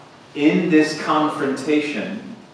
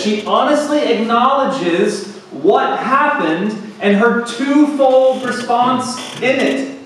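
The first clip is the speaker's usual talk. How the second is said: high, loud, emphatic sermon; impassioned pulpit voice; forceful preaching; 9 semitones higher, 5 dB louder than usual. Acted. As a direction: preach it with mounting conviction.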